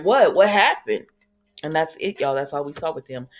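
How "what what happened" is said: alarmed. Retold or imitated. Marlena alarmed, voiced quote.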